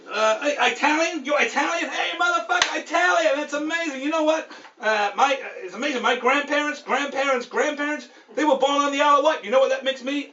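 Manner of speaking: high, excitable cocky wise guy; Joe Pesci. Acted. Kiosk man discovering the driver is Italian, cocky. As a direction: rattle on like a thrilled movie mobster. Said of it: "kind of the cocky Joe Pesci guy".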